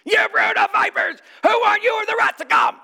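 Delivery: high, loud prophet's shout; John the Baptist shouting. Retold or imitated. Preacher voicing John the Baptist's fiery rebuke. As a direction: thunder it at the crowd. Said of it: "What does he say?".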